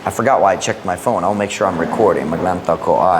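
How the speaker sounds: normal talking voice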